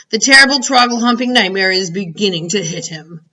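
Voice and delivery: ordinary delivery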